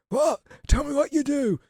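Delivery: squeaky high insistent question; imitating Johnny Vegas. Vegas pressing the audience member, high mock voice. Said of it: "And he was like".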